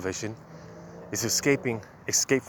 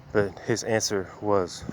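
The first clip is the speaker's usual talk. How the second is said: deliberate preacher's scripture read; slow scripture reading; slower than usual. Acted. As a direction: lean into each phrase of the verse slowly. Reads scripture slowly.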